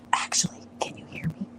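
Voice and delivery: hushed, voiceless whisper. Shown as a demonstration. Host testing the mic for listeners, whispering. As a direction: whisper it as a microphone test. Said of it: "if you can hear the whisper".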